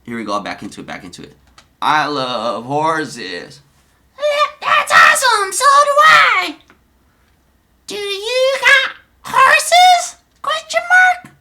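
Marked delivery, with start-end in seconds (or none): 1.8-3.6 very slow, drawn-out put-on voice; Donnie's chat voice. Streamer reading Donnie's chat message, very slow put-on voice. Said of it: "forgive my voices". 4.6-11.3 very high, loud put-on voice; girl's chat voice. Streamer as Sarah answering Donnie in chat, high loud falsetto. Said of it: "forgive my voices".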